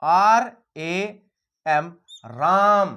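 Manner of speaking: letters spelled very slowly, then राम said whole; slow spelling. Shown as a demonstration. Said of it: "एक ले लेते हैं राम".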